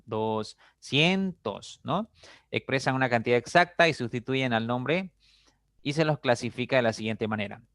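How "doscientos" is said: doscientos very slow, split as DOS-CIEN-TOS; syllable by syllable. Shown as a demonstration. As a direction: draw out doscientos to show its second syllable, CIEN. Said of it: "en la segunda sílaba". (doscientos muy lento, separando las sílabas)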